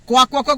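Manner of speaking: loud, chanted quack quack quack; mock quacking. Children mocking the girl with a chicken dance, loud quacking. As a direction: chant the quacks like teasing kids.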